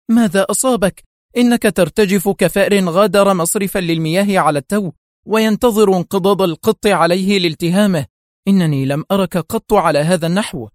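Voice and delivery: high-pitched, exasperated scolding. A narrator's reading. Wife berates her terrified husband, exasperated. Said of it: "هتفت زوجة مدير الموساد في حنق".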